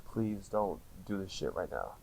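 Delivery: soft, slow, pleading under the breath; quiet plea. Retold or imitated. Praying over his baby, a soft slow plea. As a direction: beg it quietly, slowly. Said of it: "I was like".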